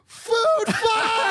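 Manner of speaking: high-pitched drawn-out shout; acted kid's food fight holler; yelled rallying cry. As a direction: yell it across a crowded lunchroom. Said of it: "and then he yells".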